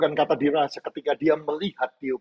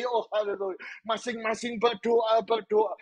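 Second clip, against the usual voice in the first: high, driving sermon voice with rising emphasis; full-force preaching; 11 semitones higher than usual. Real, not acted. Preacher urging congregation to believe in exceptions, full-force preaching.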